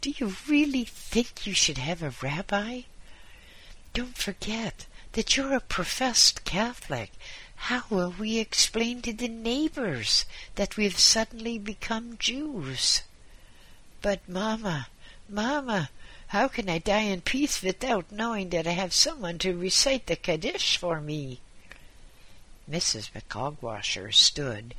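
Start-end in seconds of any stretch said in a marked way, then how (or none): 0.0-13.0 high-pitched, dismayed protest; high, incredulous questioning; exclaiming wife. A narrator's reading. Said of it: "exclaimed his wife". 14.0-21.4 high-pitched Yiddish-inflected plea; pleading old husband. Acted.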